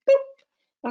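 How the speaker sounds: a very high, squeaky "boop" sound effect; cartoon boop noise. Shown as a demonstration. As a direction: pop out a silly high boop. Sound effect for exclamation point.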